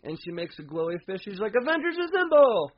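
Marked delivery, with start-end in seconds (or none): 1.6-2.7 high, loud heroic rallying shout; rallying cry. Retold or imitated. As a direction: call the team to arms. Voyager rallying battle cry.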